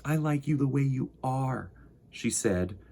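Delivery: gentle storybook narration for little listeners; reading to children. A narrator's reading. Host reading Corduroy to young viewers, gentle storytelling. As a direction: read it like bedtime story time. Reading picture book aloud.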